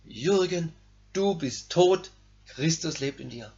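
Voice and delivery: quiet self-reminder. Retold or imitated. Preacher quietly reads his note to his old self. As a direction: softly read note to himself. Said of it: "schrieb drauf".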